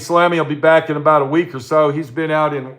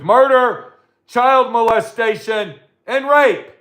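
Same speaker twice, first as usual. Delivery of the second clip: rising outrage; 7 semitones higher, slower than usual. Real, not acted. Ranting host repeats the crimes, rising to an outraged peak. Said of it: "repeats list, escalating".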